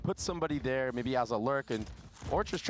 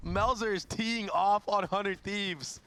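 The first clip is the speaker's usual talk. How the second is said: excited caster hype; 10 semitones higher, much slower than usual. Real, not acted. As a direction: shout it like an unbelievable highlight. Caster hypes a huge multikill.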